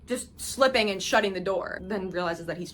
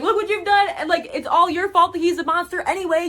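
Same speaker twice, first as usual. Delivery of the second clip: mock outburst; 7 semitones higher, 4 dB louder than usual. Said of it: "He's like".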